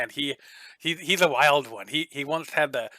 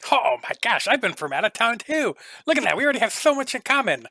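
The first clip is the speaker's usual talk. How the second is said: higher and louder, delighted; gushing gnome; 9 semitones higher, 4 dB louder than usual. Acted. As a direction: overeager friendliness, talking fast. Gnome gushes over shared hometown.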